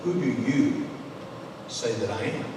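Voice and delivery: soft and low, pausing before "say that I am"; intent, hushed question. Acted. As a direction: ask it quietly, as if to each one in turn. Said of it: "would look in the eyes of each".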